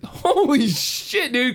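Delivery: high, stretched-out amazement; retold awestruck shock. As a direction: draw it out in mock shock.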